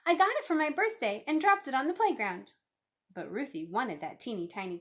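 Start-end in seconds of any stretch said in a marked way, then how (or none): 0.1-2.5 put-on high, loud child's complaint at normal pace; indignant boy explaining; crying out. A narrator's reading. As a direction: plead your case loudly to the teacher. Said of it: "cried Martin".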